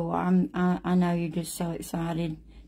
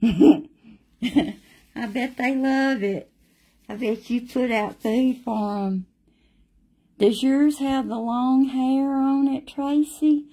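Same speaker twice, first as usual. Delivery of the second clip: higher and louder, bubbling over after a laugh; excited, laughing; 5 semitones higher, 5 dB louder than usual. Real, not acted. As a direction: gush with delight about her pets. Chatting to Tracy about her squirrels.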